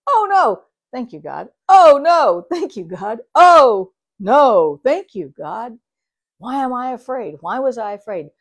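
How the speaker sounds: loud yelped oh no, then relieved thank you God; hammed-up panic then thanks; oh no cycle. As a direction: swing from alarm to gratitude each time. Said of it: "beautiful cycle of".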